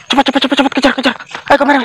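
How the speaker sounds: breathless calling out during a chase; urgent chase calls. Real, not acted. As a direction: urge the cameraman on while running after him. Urging cameraman during chase.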